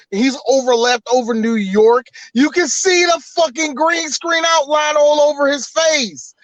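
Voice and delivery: ordinary talk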